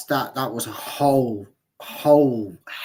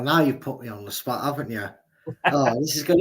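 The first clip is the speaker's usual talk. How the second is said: ordinary talk, nothing marked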